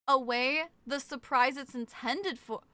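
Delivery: ordinary talk, nothing marked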